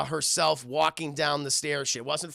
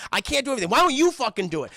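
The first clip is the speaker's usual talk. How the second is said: higher, louder mock outburst; arguing parent; 8 semitones higher, 6 dB louder, much faster than usual. Dad snapping back at mom, mock arguing. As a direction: throw it back in acted exasperation. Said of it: "They were arguing with each other".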